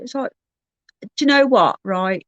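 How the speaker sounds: normal talking voice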